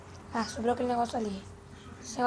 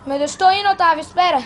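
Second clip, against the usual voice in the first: loud, higher, urgent call; urgent shout; 4 semitones higher, 10 dB louder than usual. Acted. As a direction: yell while hurrying after him. Rushing to reach friend.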